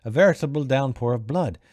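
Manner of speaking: odd, theatrical character voice; hammed-up weird storybook talker; Kroop. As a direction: declaim it with lilting, swooping pitch. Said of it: "the voice I did for that guy".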